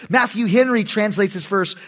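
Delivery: ordinary talk, nothing marked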